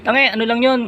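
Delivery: lightly mocking chiding; a touch of scolding wife. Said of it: "sabi niya".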